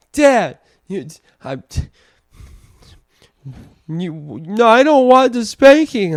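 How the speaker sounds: put-on high, loud whiny child voice, pleading; crying toddler imitation. Dad imitates son begging not to be spanked, high whiny wail. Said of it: "And he's like".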